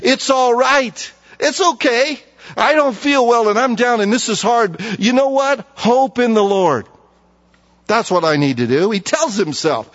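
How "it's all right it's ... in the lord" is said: higher, slow soothing reassurance; calm yourself down gently; reassuring self-talk. Shown as a demonstration. Preacher showing how to comfort yourself, slow and reassuring. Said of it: "you'll remind yourself".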